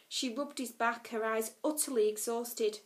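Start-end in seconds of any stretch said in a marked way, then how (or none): none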